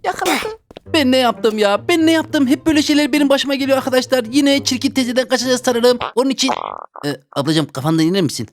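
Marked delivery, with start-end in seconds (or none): 0.0-6.5 put-on squeaky panicked little-kid voice; high frightened kid voice. Kid fleeing the caretaker who chases him: high panicky whine. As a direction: whine while running from her.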